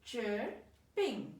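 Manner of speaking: CHIRPING broken in two, CHIR, then PING; two parts. Shown as a demonstration. Teacher pointing out two parts, CHIRPING split by syllable. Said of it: "See these two parts?".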